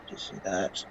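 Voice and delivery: low, hushed aside to a companion; barely audible conspiratorial murmur; whisper. Acted. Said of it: "whisper to Supernova".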